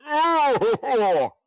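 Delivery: high, loud shuddering oh. Acted. Brownlow shudders at the housekeeper's word. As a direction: recoil at the word. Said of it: "with a slight shudder".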